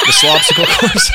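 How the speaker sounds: high squealing laugh over the words; cracking up. Real, not acted. Host breaking up over the slopsicle course, high laughing. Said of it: "He broke me, man".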